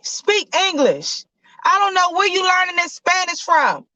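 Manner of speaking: angry dad shouting at his son in hospital; yelling dad. Acted. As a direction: yell at your son to speak English. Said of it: "please stop yelling at your son".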